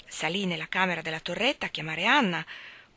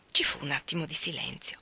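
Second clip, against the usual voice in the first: plain reading voice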